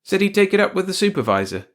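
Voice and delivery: high, loud report to the wife; lightly voiced husband. A narrator's reading. George reports back to wife.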